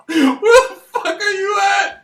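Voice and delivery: high, loud, laughing holler at the niece; hollering uncle. Retold or imitated. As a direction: yell it like a wild uncle on the porch at 3am. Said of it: "he's all like".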